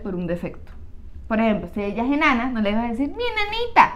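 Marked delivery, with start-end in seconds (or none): 3.1-3.9 mock pet name.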